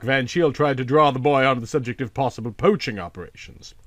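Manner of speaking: ordinary delivery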